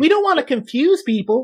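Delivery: high-pitched mock explanation; imitated official. Voices officials in retold documentary.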